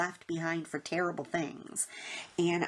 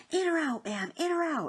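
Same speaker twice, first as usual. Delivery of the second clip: high, insistent mock yell; retold soldier's yell; 11 semitones higher than usual. Soldier repeating his order to a confused driver, mock yelling. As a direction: imitate an urgent shouted order. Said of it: "he just repeated himself".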